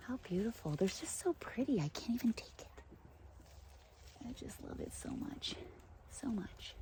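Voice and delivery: breathless awed whisper. Real, not acted. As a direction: hush it, overwhelmed with delight. Awed whisper among the trees.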